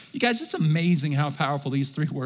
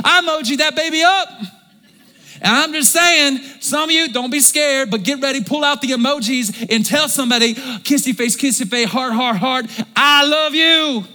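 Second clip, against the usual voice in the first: preaching at full force; 8 semitones higher, 6 dB louder than usual. Acted. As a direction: build it up and hammer it home. Preaching, urging congregation to text.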